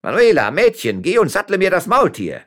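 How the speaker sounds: loud, high-pitched order; calling the maid. A narrator's reading. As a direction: shout instructions to the next room. Mayor calls orders to maid.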